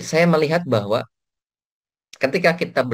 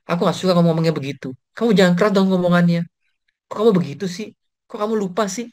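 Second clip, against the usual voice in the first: higher, louder whiny complaint; mock nagging partner; 6 semitones higher, 4 dB louder than usual. A spouse complaining about tone, higher and whiny. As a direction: nag like an annoyed partner.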